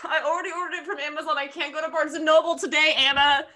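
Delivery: high, rushed complaint rising in volume; exasperated whine. Real, not acted. Already ordered the book, complaining to friend, exasperated.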